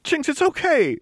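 high, loud pleading comfort; high-pitched, loud, urgent calming voice; desperate reassurance. Acted. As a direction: reassure her over the noise. Lux calming panicking Jinx.

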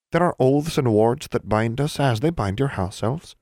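a prim little elf explaining his folk; proud elf. Acted. Elf Seas from Laurel speaking.

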